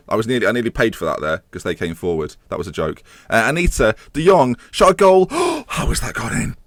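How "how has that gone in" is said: gasp, then a high, slow, stunned question; shocked gasp. Real, not acted. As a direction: caught completely off guard. Goal conceded in disbelief.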